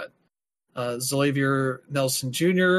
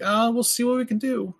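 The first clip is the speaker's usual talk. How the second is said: higher-pitched, mock noncommittal quote; noncommittal hedging; 10 semitones higher than usual. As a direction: imitate a company lightly hedging its promise. Imagined company hedging instead of promising.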